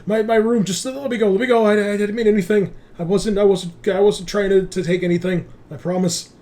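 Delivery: high, loud, stuttering pleading; frightened stammering. Acted. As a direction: stammer in panic, promising innocence. GM voices stammering frightened doctor.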